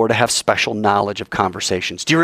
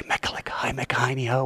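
soft unvoiced whisper; 9 dB quieter than usual. Shown as a demonstration. Lover whispering a secret code word, whispered. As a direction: breathe the code word in an ear. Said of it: "You whisper it".

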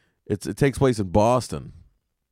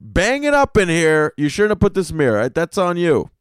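louder, higher warning; mock warning to the hotel; 5 semitones higher, 5 dB louder than usual. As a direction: lay down the law to the room, half joking. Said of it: "I was like".

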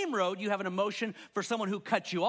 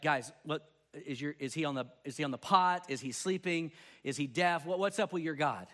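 scoffing taunt, pitched a bit lower; mocking; 6 semitones lower than usual. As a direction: taunt the false prophets, half-laughing. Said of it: "Mocking them".

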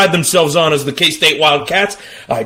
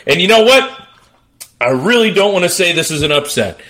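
ordinary delivery

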